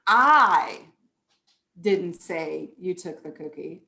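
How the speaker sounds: "I" hit hard and loud, the rest of the sentence dropped low; stress on I. Shown as a demonstration. Teacher showing parents how stressing "I" changes the meaning. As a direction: punch "I", then let "didn't say you took the cookie" fall away. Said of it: "Do you see how inflection just changes the meaning".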